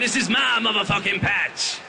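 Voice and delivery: a monster's territorial shout; a monster claiming his spot under the bed. Acted. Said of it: "He went".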